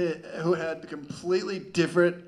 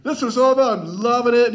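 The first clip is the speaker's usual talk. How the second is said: high, loud pumped-up whooping; gung-ho rider; 10 semitones higher, 7 dB louder than usual. Retold or imitated. Gung-ho guy on the right loving the coaster, whooping excitedly. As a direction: whoop like a thrill junkie on the ride. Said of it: "he's like".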